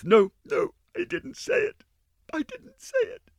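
very high panicked take-back; frightened denial. Acted. Giry takes back her words, frightened. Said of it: "corrected herself, hands to mouth".

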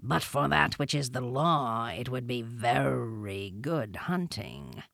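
low, soft and drawn out; low, quiet panther mutter; muttered between teeth. A narrator's reading. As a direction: mutter it slowly through the teeth. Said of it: "between his teeth".

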